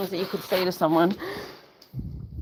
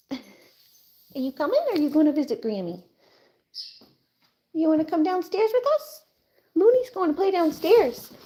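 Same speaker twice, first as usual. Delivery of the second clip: higher sing-song coaxing, quick at the start; coaxing pet voice; 7 semitones higher than usual. Real, not acted. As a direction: coax the puppy down with a sweet lilt. Coaxing the dog downstairs.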